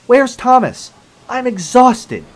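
loud, high-pitched grumble; fuming complaint. A narrator's reading. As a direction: complain loudly, worn out and fed up. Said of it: "he complained".

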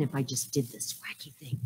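a soft, unvoiced whisper; hushed whisper sharing a mischievous idea; conspiratorial whisper. Real, not acted. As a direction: whisper it like a naughty secret. Imagining a wacky secret idea.

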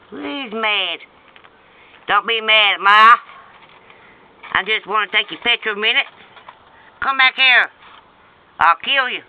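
high and loud, fast; excited. Real, not acted. Chasing the doodlebug to photograph it, excited. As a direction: call after the runaway bug.

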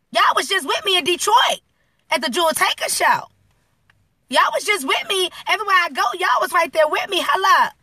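high, pleading disbelief; retold breakdown. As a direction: voice climbing high with hurt confusion. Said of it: "I broke down 'cause I was like".

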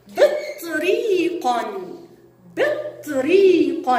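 بطريق said very slowly, final ق clear; slow teaching demo. Shown as a demonstration. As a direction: stretch بطريق for young learners. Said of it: "جاء حرف القاف في آخر الكلمة متصلة". (نطق بطريق ببطء شديد)

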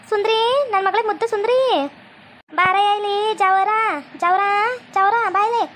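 a hint of a lovey-dovey call; sweet calling out. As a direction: coo his name across the yard, teasingly. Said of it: "ಸಾರಥಿ ಹಿಂಗ್ ಕೂಗ್ತೀರಿ?".

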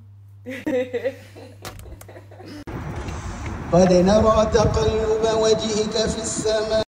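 loud, long fit of laughter; real happy laughter. Real, not acted.

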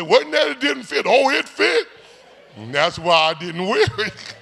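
high, loud gleeful retelling; punchline delivered high and loud; woman's reply. Retold or imitated. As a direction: act out her cheeky answer. Joke dialogue, woman answering.

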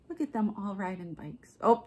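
ordinary delivery

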